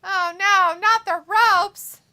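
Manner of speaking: loud, high, stretched-out mock dismay; mock alarm. Co-host answering the call about the ropes, mock alarm. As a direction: wail it in pretend horror.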